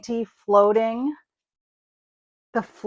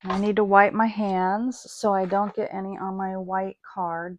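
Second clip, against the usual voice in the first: usual speaking voice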